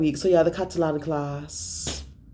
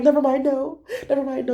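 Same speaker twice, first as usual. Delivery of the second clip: high, giggly brush-off; laughing brush-off; 9 semitones higher, 3 dB louder, faster than usual. Retold or imitated. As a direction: wave it away through laughter. Friends laughing, brushing her off.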